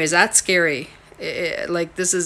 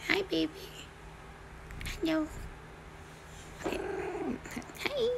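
much higher, soft pet voice; cooing to the cat; 12 semitones higher, 13 dB quieter, faster than usual. Real, not acted. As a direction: coo it to a cat. Greeting her cat.

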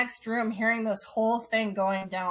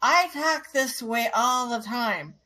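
put-on slow, slurred, louder drunk insistence; 2 semitones higher, 4 dB louder, slower than usual. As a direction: drag the words like a drunk. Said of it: "in his drunken voice".

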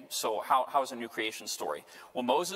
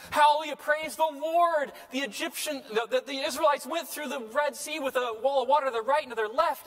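ecstatic praise; 13 semitones higher, 5 dB louder than usual. Retold or imitated. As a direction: sing out the praise like a giddy convert. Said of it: "He goes".

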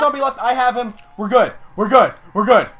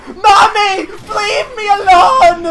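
loud, high, drawn-out mock cry for help; hammed-up child's wail; 6 semitones higher, 6 dB louder, much slower than usual. Player chased in zombie game, mock-childish wail. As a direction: wail it like a terrified kid, played for laughs.

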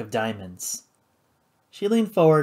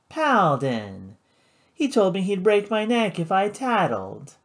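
slurred; 6 semitones higher than usual. Acted. Flora to the judge, slurring drunkenly. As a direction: let the words smear drunkenly. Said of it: "she slurred".

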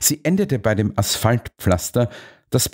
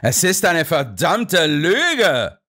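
furious shout; 8 semitones higher, slower than usual. A narrator's reading. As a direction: hurl the accusation back. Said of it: "schrie er".

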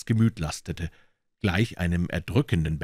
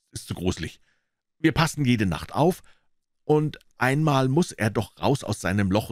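put-on higher, earnest boy voice; boyish Tom; 5 semitones higher than usual. Tom planning with Huck, earnest boyish voice. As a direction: lay out the plan earnestly.

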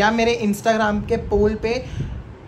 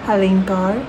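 fake Harleen Kaur. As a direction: play a polite Punjabi woman calling a stranger. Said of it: "ना कि कोई और हरलीन कौर".

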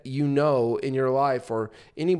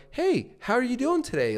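rising like a question; 10 semitones higher than usual. Shown as a demonstration. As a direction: pitch sweeps up high at the end. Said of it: "almost like a question mark at the end".

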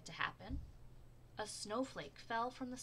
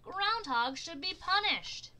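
high, loud, slow demand; angry demand; 10 semitones higher, 11 dB louder, slower than usual. A narrator's reading. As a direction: insist on punishment. Animals demand punishment.

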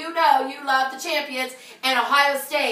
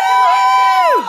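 ecstatic shout; 14 dB louder than usual. Real, not acted. Congratulating Tara Griffin, high ecstatic shout. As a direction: scream it in celebration. Cheering top winner.

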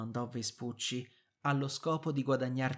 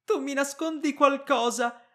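panicked cry; 13 semitones higher, 9 dB louder than usual. Acted. Wife panics over brother's fate.

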